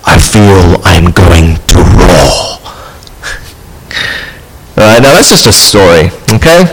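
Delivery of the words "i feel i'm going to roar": much lower, slow lion's voice; lion on the verge of a roar; Aslan's voice. Acted. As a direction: rumble it like a great lion about to roar. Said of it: "said Aslan".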